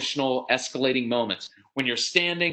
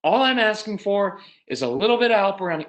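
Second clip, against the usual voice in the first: higher, louder exasperated parent; needy pleading; 7 semitones higher, 4 dB louder than usual. Shown as a demonstration. Worn-out parent begging the kids for help, needy. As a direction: demonstrate an emotional, imploring tone. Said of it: "The moment you start talking like this".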